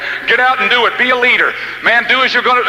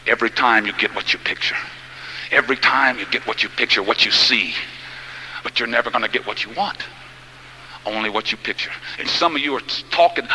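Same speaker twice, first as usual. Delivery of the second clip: much lower and softer, confiding; hushed preaching; 11 semitones lower, 8 dB quieter than usual. Real, not acted. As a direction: draw them in with a low, intimate voice. Shifts into low intimate preaching.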